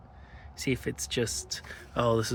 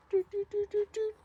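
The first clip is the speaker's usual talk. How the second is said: high piping doot doot beeps; playful high-pitched beeping sound; beeping noise. Pretend. Imitating a beeping car being pushed.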